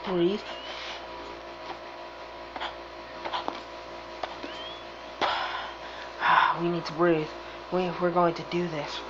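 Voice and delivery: soft, hushed self-soothing; steady yourself quietly; calming oneself. Real, not acted. Calming self down before race.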